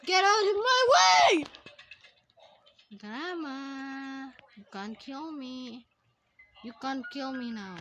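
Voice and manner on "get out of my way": high, loud shout climbing up; urgent yell. Real, not acted. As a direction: yell to clear the way. Yelling at game obstacle.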